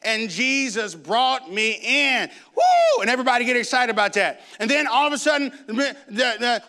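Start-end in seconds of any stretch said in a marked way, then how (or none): 0.0-2.3 slow, stretched church testimony; Sister Jenkins. Retold or imitated. Church sister retells being saved, slow imitated testimony. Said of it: "Sister Jenkins always talked about". 2.5-3.0 very high, loud "woo"; imitated church whoop. Congregation gets excited about the testimony, loud high imitated whoop.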